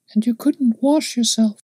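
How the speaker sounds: put-on high child's voice, serious question; grave little girl. A narrator's reading. Little girl interrupts the man gravely, high child voice. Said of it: "interrupted his companion gravely".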